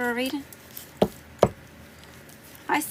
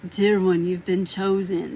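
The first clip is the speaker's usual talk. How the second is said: mock scammer voice; 3 semitones lower, slower than usual. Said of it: "I don't even talk like that".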